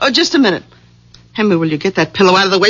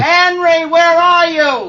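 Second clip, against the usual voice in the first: high call from another room; yelling for someone; 7 semitones higher, slower than usual. Acted. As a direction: call out and stretch the words. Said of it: "She's yelling for me".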